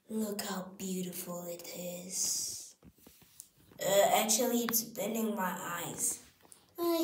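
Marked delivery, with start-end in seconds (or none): 0.1-2.8 whispering. Acted. Whisper, unvoiced toy play.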